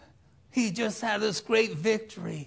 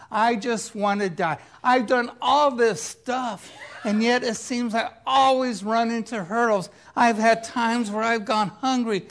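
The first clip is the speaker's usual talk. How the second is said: loud, high, exasperated complaint; fed-up outburst; 5 semitones higher, 7 dB louder than usual. Acted. Prophet tells God he has had enough, exasperated. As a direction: protest loudly like you're at breaking point.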